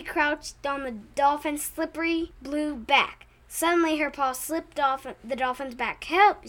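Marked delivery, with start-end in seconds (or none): 6.1-6.5 loud call for help; yelled help. A narrator's reading. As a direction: yell it out in a panic. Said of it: "she yelled".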